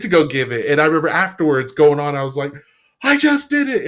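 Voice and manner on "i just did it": much higher, louder burst of joy; ecstatic cry. Retold or imitated. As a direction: cry it out in disbelief after the talk. Right after giving the talk.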